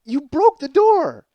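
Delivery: stunned, slow, disbelieving wife; shocked wife. Retold or imitated. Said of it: "And she's like".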